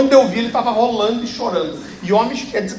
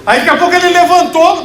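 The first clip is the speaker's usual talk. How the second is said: excited telling; 6 semitones higher, 6 dB louder than usual. Real, not acted. Excited story climax.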